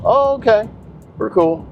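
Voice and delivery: high chirpy mock agent voice; mimicking agent. Agent's cheerful reply, mimicked.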